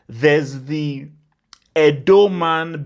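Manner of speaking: ordinary delivery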